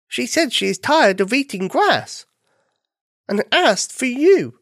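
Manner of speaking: high-pitched bunny voice; little bunny, explaining. Acted. Bunny passing the cow's request to dog, earnest.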